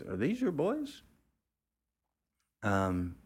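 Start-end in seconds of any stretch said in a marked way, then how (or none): 0.0-1.0 much higher, put-on voice of a matronly small-town woman. Gas station woman spots Charlie, high excited matronly voice. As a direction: squeal it out like an excited country matron. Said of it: "She goes".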